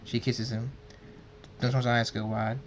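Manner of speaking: plain reading voice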